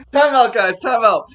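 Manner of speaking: loud call-out. Acted. NPC calls time out loudly.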